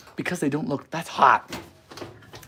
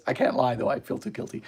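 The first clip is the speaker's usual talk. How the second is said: unvoiced guilty whisper; 2 semitones lower, 6 dB quieter than usual. Real, not acted. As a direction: whisper the confession. Admitting a mistake.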